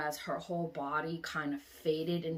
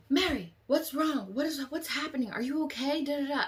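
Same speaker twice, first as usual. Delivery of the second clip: higher, louder worried calling out; alarmed high concern; 8 semitones higher, 4 dB louder than usual. Retold or imitated. Friends calling out to Mary in alarm, high and worried. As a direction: rush to her in alarm. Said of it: "So we were like".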